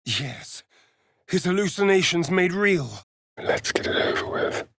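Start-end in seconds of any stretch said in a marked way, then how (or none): 3.4-4.7 whisper. Acted. Game companion whispered bark.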